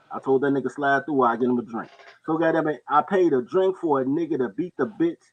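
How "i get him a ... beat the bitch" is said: ordinary talk, nothing marked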